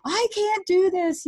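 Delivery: high, pleading inner cry. Retold or imitated. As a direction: beg him not to let this happen. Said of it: "I was pleading".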